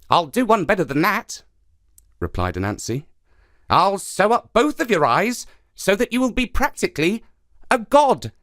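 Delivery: high, sly spider voice; lively trickster spider, eager and sly; Anansi the trickster. Acted.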